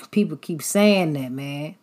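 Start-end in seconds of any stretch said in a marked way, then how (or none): none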